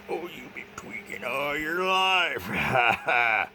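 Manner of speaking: high, slow, mocking sing-song taunt. Mocking the people who target him, high and slow. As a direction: drag it out high to mock them. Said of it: "they try to say".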